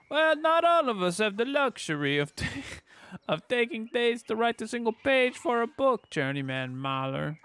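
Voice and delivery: high, loud, badly faked Italian; hammed-up Italian accent breaking into a laugh; poor Italian accent. Player voicing a gibe at Journeyman Mahler, poor Italian accent. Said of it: "try to do a poor Italian accent".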